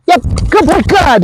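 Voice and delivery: high-pitched excited praise; real high, eager cheering to a dog. Real, not acted. Trainer praising dog in play.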